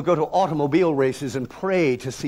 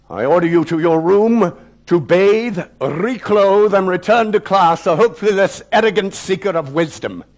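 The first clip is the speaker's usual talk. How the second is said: louder, measured, icy headmaster; cold calm; 4 semitones higher, 5 dB louder than usual. Acted. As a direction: pronounce the sentence with no mercy. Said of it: "he said with a calm devoid of anything remotely resembling human understanding".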